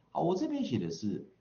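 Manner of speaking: usual speaking voice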